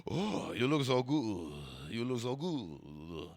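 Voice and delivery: slow, stretched swoon in a fake Quebec accent; mock Quebecois woman. Quebec woman admiring his look, drawn-out mock French accent. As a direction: gush slowly like a caricature French-Canadian. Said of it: "She's like".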